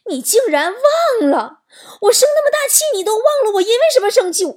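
high, loud, indignant scolding; wife blowing up at her husband; acted outrage.

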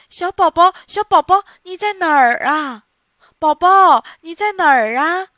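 put-on loud anxious calling for the baby; panicked mother shouting for her lost baby; frantic calling. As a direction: call out desperately into the dark. Mother searching in the dark.

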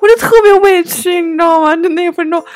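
high, loud, whining mock complaint; deeply wronged. Customer venting about his sudden change of tone, wronged. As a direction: protest as if close to tears.

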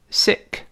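sick with a short i; model reading. Shown as a demonstration. Teacher leading students to repeat sick. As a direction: clip the vowel short in sick. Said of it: "跟老师读一下sick".

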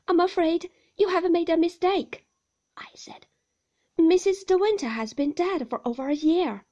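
blurting it out in alarm; flustered. A narrator's reading. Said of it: "trembling hands".

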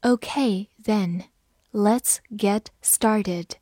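slow, deliberate read-aloud; slow classroom model reading. Shown as a demonstration. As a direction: stretch each word out for learners. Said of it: "好，慢读一遍。".

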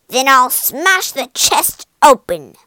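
loud pirate threat; crying out. Acted. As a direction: shout the threat like an angry pirate. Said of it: "he cried".

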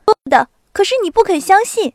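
高而响的得意口气; 像赢了一样炫耀地说; 得意洋洋. A narrator's reading. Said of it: "他得意洋洋地说". (得意地嚷)

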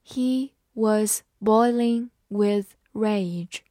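slow, word-by-word model reading. Shown as a demonstration. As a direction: separate every word with a pause. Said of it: "慢慢来读".

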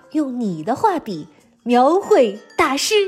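ordinary talk, nothing marked

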